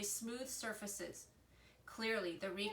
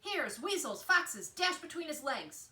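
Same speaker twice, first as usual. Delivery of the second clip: a touch higher, louder fox command; barked fox orders; 6 semitones higher, 6 dB louder than usual. A narrator's reading. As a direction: send the runners in, lightly voiced. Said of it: "Fink barked orders from below".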